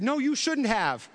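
put-on high, slow child voice; prim little kid agreeing; son's retort. Son accepting dad's apology in a lightly voiced child tone. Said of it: "And he said".

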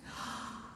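a sharp theatrical gasp; staged gasp. Acted. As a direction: gasp in mock suspense. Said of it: "gasp announcing next nation".

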